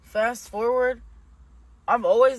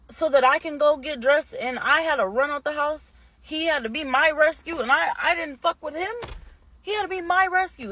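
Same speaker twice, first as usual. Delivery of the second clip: worked-up, higher-pitched venting; real upset outrage; 6 semitones higher than usual. Real, not acted. Daughter recounting her rescue from a drunken fight, upset and indignant. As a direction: pour it out in indignant disbelief, pitched high.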